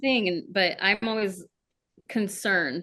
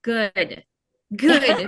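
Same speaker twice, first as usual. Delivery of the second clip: emphatic good; 8 dB louder than usual. Retold or imitated. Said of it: "I'm just like".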